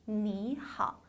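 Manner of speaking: 你好 with 你 rising and 好 low; changed tones. Shown as a demonstration. Teacher showing tone change, 你好 rising then low. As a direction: raise 你 simply, keep 好 in third tone. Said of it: "앞에는 그냥 올려만 줘요".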